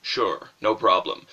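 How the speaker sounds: low, slow sarcastic agreement; deep-voiced game partner being sarcastic. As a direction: agree slowly with no intention of helping. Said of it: "he's sarcastic as well".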